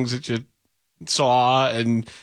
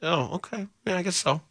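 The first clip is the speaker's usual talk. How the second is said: mock double take; 5 semitones higher, 4 dB quieter than usual.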